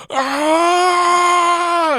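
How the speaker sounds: long, high, loud scream of pain; agonized scream. Acted. Villain with his eye ripped, high sustained scream. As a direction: scream it out at full force. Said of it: "screams out".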